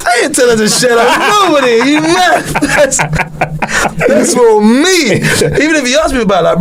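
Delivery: high giggly voice through laughter; laughing, can barely get it out. Real, not acted. Laughing while refusing to share his girlfriend's secrets.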